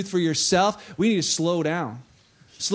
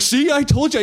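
higher, louder I-told-you-so; raised voice, proud of being proved right; excited retold boast; 6 semitones higher, 5 dB louder than usual. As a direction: act out a friend's eager gloating. Friend gloating over the catch.

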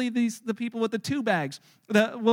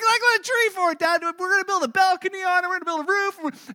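put-on high, louder gushing child voice; excited kid; 8 semitones higher, 5 dB louder than usual. Son describing his treehouse plans, high excited voice. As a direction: rattle off plans like an eager boy.

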